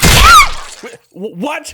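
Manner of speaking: sudden high alarmed cry; stammering yelp of alarm; startled shout. Acted. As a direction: jolt up in shock and stammer it out. Sudden alarm at bear trap.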